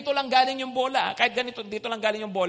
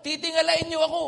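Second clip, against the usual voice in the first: quick, louder, higher determined vow; hopeful vow; 6 semitones higher, 3 dB louder, much faster than usual. Retold or imitated. Preacher voicing a striving person, quick upbeat vow. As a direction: boast of a bright future. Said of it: "yung sasabihin".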